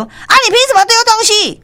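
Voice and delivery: high, sharp indignant challenge; angry retort. Retold or imitated. Store manager to the husband, angry retort. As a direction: snap back at him, outraged. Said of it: "反击讲".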